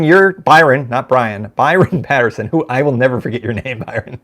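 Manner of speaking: ordinary delivery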